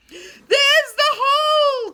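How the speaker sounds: slow, high, loud shout of discovery; drawn-out excited call; relieved cry. A narrator's reading. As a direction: cry out as you spot the way home. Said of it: "cried Joe at last".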